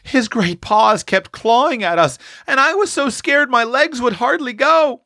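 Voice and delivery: put-on high piping child's voice; small child hiding, piping out her fear; piped out. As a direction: pipe it up from behind the skirts. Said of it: "piped out".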